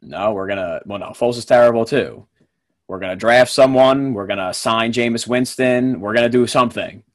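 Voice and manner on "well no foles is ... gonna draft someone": ordinary talk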